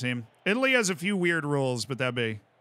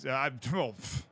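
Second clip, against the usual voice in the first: usual speaking voice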